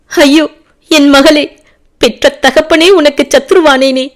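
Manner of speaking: high, loud anguished wail; wailing father. A narrator's reading. Father wailing over his captured daughter, loud anguished cry. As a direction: cry out to the lost daughter in grief. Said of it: "என்று கதறிய வண்ணம்".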